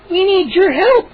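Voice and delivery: high, slow plea; pleading gnome. Acted. Gnome pleading with the children. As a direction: beg them for help. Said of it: "Hap added".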